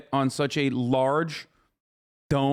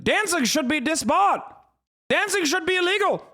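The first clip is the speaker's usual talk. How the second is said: high, mock-prim preacher voice; mocking old-fashioned moralist; 14 semitones higher than usual. Streamer mocking the commentator as a prim moralist, high and scandalized. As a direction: parody a scandalized puritan, pitched way up. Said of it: "literally upset that people are dancing".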